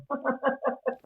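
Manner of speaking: a high, breathy burst of laughter; real laugh. Real, not acted. Guest laughs at the investigator's wild theory, real laughter. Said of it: "I laughed".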